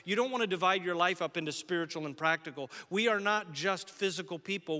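ordinary delivery

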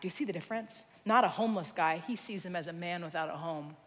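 low, quiet explanation; soft preaching. Real, not acted. Preacher explaining compassion to the church, quietly. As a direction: bring it down low and soft for the lesson.

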